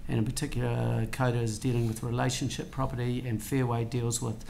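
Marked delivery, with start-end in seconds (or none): none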